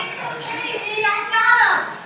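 high, booming mock reassurance; husband reassuring loudly. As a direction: holler it back over a worried wife. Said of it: "And he's like".

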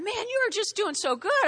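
high-pitched, impressed doctor; amazed doctor. Acted. Doctor taking patient off medication, amazed.

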